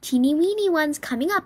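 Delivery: high, perky waitress chirp. A narrator's reading. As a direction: chirp it with a rising end. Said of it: "I chirped".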